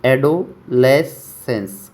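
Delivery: एडोलेसेंस said very slowly and evenly; teacher drilling एडोलेसेंस slowly; slow pronunciation demo. Shown as a demonstration. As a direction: drag out each syllable of एडोलेसेंस. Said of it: "कैसे याद करना है?".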